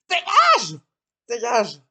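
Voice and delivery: loud angry yell; furious shout. Acted. Acted quarrel, throwing someone out.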